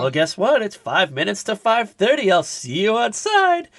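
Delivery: high singsong, climbing and stretched at the end; hammed-up flirty come-on; coy mock invitation. Acting out Missus Slocum inviting Mister Lucas outside, coyly singsong. Slocum accepts the rendezvous suggestively.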